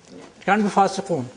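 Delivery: ordinary talk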